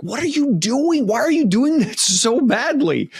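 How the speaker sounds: high, loud exasperated yell; yelling at the page. Pretend. Reader yelling at a book character, loud and high. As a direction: holler in disbelief at a character's bad choice. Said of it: "make you wanna yell at the page".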